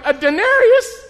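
loud, high-pitched indignation; ticked-off disbelief. Acted. Nine o'clock worker seeing his pay, indignant. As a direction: burst out in outraged disbelief. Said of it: "And he's ticked".

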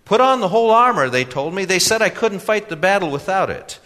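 put-on high, loud, insistent young man; protesting young customer. A narrator's reading.

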